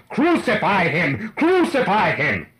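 loud, high, drawn-out shout; awful mob cry. A narrator's reading. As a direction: roar it like a crowd baying for death. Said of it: "raised that awful cry".

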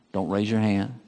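normal talking voice

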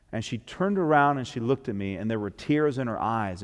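ordinary talk, nothing marked

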